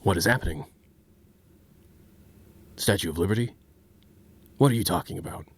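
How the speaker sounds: low, deadpan gruff voice; J C Denton voice. Retold or imitated. Streamer answers a chat request with a low deadpan agent. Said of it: "he sounds like".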